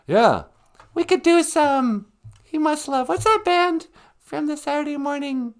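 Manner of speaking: very high squeaky sidekick voice; high-pitched sidekick. Acted. Sidekick answering the host about a band, high falsetto. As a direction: chirp it all in falsetto.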